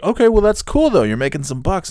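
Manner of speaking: high-pitched put-on positivity; acted looking-on-the-bright-side pitch; forced upbeat. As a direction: overcompensate with cheery encouragement. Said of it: "I'll go to the opposite".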